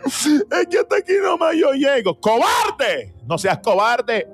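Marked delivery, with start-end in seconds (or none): 0.1-2.2 higher, crying coward's lament; mock wail. Preacher mocking fearful believers, crying lament. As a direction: whine it out, near tears, pitched up. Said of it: "están hablando acerca de su final".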